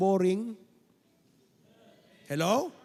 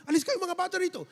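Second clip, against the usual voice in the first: quick shooing; 7 semitones higher, much faster than usual. Acted. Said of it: "sabi ng mga disipulo".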